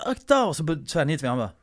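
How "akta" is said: high, loud "Akta!"; warning shout. Retold or imitated. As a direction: yell a sudden warning. (högt varningsrop)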